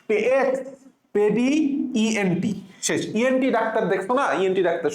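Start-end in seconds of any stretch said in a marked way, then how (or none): 0.4-1.5 এক্সপেডি slowly, with a long rising last syllable; এক্সপেডি in slow separate chunks; sounded-out syllables. Shown as a demonstration. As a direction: stretch out এক্সপেডি for the class. Teacher sounds out the spelling.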